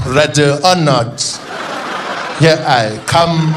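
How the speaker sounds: slow, loud, drawn-out politician voice; hammed-up seducer impersonation; Jacob Zuma. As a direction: announce it with lumbering relish. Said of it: "And he's like".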